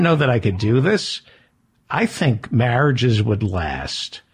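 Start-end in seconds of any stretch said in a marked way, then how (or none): 1.9-4.2 slow, drawn-out. Pretend. Slow deliberate joke.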